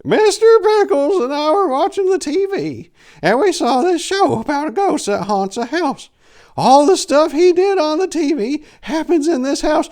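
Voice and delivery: put-on very high, loud old-lady falsetto; old lady Pickles. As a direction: squeal it out like a frantic old woman. Old lady insists house haunted.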